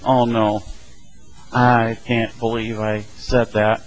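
low, slow mock dismay; regretful. Someone realizing what their tongue just did, low regretful dismay. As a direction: let it sink in with an understated groan of regret. Regretting careless words, acted.

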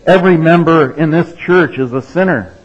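loud, high fire-and-brimstone thunder; thundering preacher. Retold or imitated. Pastor thundering at his congregation, loud. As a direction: boom it from the pulpit. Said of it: "the pastor thunders again".

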